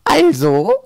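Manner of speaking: high, loud one-word demand; grating crone voice. A narrator's reading. Old woman questioning her weasel, grating demand. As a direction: rasp it sharply as a mad old woman. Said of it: "mit einer Stimme wie eine Käsereibe".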